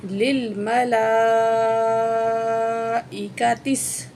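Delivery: لِلْمَلَائِكَةِ with the long vowel held for four counts, slow; long madd. Shown as a demonstration. Teacher shows the four-count stretch to students. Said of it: "چار الف کے برابر کھینچ کے پڑھیں گے".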